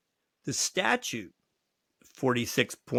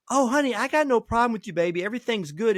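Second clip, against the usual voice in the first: high-pitched, breezy imitation of a woman; mock sweet placating client; 9 semitones higher, 3 dB louder than usual. Client placating the lawyer she reported, mock sweet. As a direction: brush it off with high, sugary reassurance.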